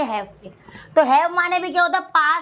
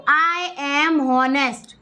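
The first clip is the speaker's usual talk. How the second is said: very slow and deliberate; slow model sentence; much slower than usual. Shown as a demonstration. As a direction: lay out each word for the class. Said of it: "इसको honest बोलते हैं". (बहुत धीरे, साफ़-साफ़)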